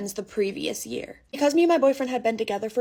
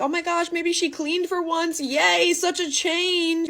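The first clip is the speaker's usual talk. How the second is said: bright, much higher mock-cheerful hope; happy; 7 semitones higher, slower than usual. Coming home to a clean apartment, mock delighted. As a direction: squeal it like you hope she finally cleaned. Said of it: "and I'm all happy".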